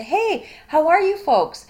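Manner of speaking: high, loud, upbeat hello to a class; big chipper lecturer's greeting; cheery greeting. Acted. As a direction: greet the room brightly and loudly. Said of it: "I'll say".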